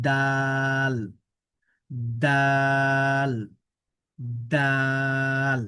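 দাল stretched very slowly, tongue tip on the upper teeth; drawn-out demo. Shown as a demonstration. Teacher drills students in a very slow, drawn-out দাল. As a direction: hold দাল long and steady. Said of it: "এভাবে বলার চেষ্টা করুন".

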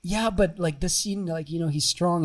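high-pitched objection to a teacher; mock-whiny pushback, retold; acted protest. Acts out protesting to his teacher.

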